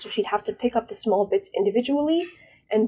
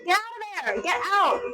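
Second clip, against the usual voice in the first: high-pitched frantic get out, far above her usual voice; shrill yelling; 11 semitones higher than usual. Real, not acted. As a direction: scream at her to leave the room. Screaming mom out of her room.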